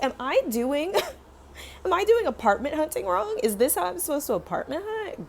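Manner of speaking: high, incredulous self-questioning; retold bewilderment. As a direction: act out baffled self-doubt with a laugh in it. Retold bewilderment after losing the apartment.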